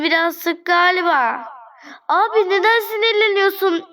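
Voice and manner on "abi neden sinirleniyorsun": whiny, higher and louder plea; a frustrated, whining question; exasperated. Real, not acted. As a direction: complain to the angry customer, voice rising. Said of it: "upset with the customer".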